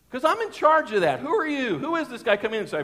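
high, loud mock indignation; indignant inner rant. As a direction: protest like a territorial man, hammed up. Acts out his indignant inner reaction.

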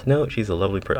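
ordinary talk, nothing marked

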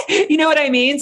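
laughing while asking. Real, not acted. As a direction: laugh through the question. Laughing after retold shock.

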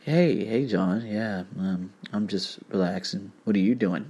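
low, soft lazy mock drawl; mock a lazy, bored caller; lazy guy. Lightly mocking a bored guy calling a friend, lazy. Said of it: "another guy that goes".

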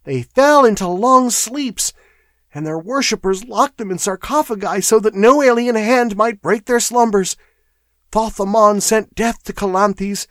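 high-pitched, alarmed clerk; frightened chatter. A narrator's reading. Shaking clerk warning of a lurking horror, frightened. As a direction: warn them with mounting dread. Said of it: "chattered Primero".

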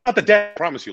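high, hammed-up protest; comic nervous kid pleading innocence; mock panicked denial. Young agent to client about the baby, mock panic.

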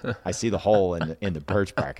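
ordinary talk

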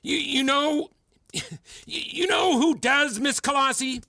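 worked-up tirade. Acted. Mentor accusing student of expecting mind-reading, agitated tirade.

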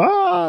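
high mock squeal of joy; imitated excited scream. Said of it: "like, very excited".

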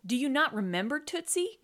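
usual speaking voice

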